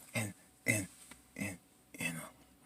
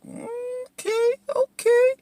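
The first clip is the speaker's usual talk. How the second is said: very high squeaky falsetto; mock alarmed reaction; much slower than usual. Viewer reacting to a TV shooting, squeaky mock alarm.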